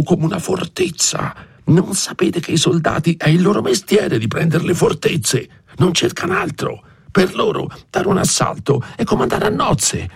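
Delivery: irate, urgent stage whisper; hushed anger. A narrator's reading. Frightened priest scolding his housekeeper about soldiers, hushed and irate. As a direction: scold furiously without letting anyone overhear. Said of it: "rispose con voce bassa ma iraconda".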